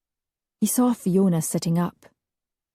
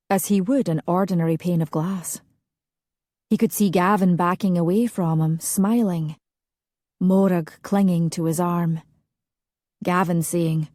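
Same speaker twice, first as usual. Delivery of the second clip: plain reading voice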